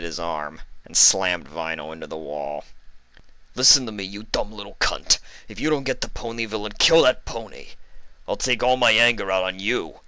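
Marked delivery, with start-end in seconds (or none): 3.5-10.0 lightly voiced menacing boss; threatening Don. A narrator's reading. The Don pinning Vinyl to the wall, a hint of menace.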